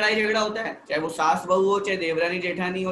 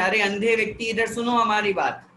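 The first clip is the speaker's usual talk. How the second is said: rude call; 4 semitones higher, 4 dB louder than usual. Shown as a demonstration. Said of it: "और हम उसको बोल रहे हैं".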